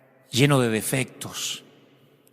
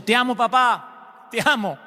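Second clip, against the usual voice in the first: insistent love; 7 semitones higher than usual. A narrator's reading. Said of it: "pero continúa diciendo".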